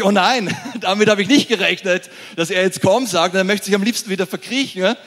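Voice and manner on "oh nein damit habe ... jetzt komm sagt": louder and higher, laughing mock panic; panicked Peter, laughing. Peter thinks this when Jesus calls him, loud laughing mock panic. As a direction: blurt out a laughing oh no. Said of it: "Und Petrus denkt sich".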